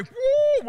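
high, loud "woo"; excited whoop. Retold or imitated. Preacher imitating the buffalo rolling up, high loud whoop. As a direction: let out a high whooping cry.

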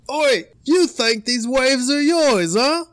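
loud, high, slow Aussie taunt; Australian accent. Acted. Australian surfer confronting Hawaiians over waves, loud Australian accent. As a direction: challenge them in a drawn-out Australian accent. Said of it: "How would an Australian sound talking shit".

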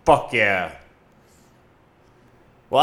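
usual speaking voice

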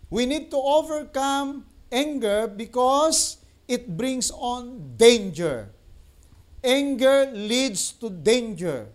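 slow, drawn-out preaching punchline; slow emphatic slogan. Shown as a demonstration. Preacher hammering his slogan to viewers, slow and weighty. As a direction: stretch out "Anger leads to danger" for the crowd. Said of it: "preaching slogan".

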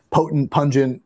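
ordinary talk, nothing marked

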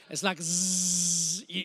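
slow drowsy painkiller haze; drowsy drugged drift. Retold or imitated. Preacher mimics drifting on painkillers, slow and drowsy. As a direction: drag it out like you're half asleep.

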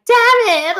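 high, loud drawn-out cry of dismay; retold group outcry. Coworkers hearing she is leaving, loud dismay. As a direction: yell it out in mock frustration. Coworkers react to announced departure.

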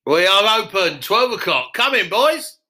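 hammed-up landlord plugging his pub; Barry Barr voice. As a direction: plug the show like a loud pub landlord. Barry Barr character voice held.

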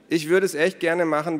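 high, loud mock regret; breezy, insincere excuse; feigned willingness. A narrator's reading. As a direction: claim it with fake eagerness. Ada claims false willingness.

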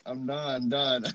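usual speaking voice

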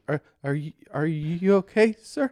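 high, halting, stammered question; scared. Retold or imitated. Asking injured man if he's okay, scared. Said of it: "scared to touch".

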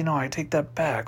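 ordinary delivery

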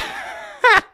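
very high laugh breaking into a cry; helpless giggle fit; high cackling laugh. Real, not acted. Cracks up after bleat.

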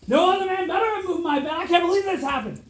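loud, high jealous outburst; shouting, outraged at the thought of another man; furious Odysseus. Acted. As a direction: roar it like a betrayed husband. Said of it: "He's like".

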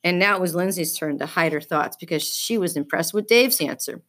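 usual speaking voice

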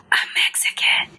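hushed, imitated confiding whisper. Mother naming her daughter's fiancé's background, whispering. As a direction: whisper it like a taboo word. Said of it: "she whispers it".

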